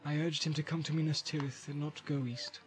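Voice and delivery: hushed, guarded Boromir voice; hesitant answer. A narrator's reading. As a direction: confess it quietly, with pauses. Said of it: "He answered slowly".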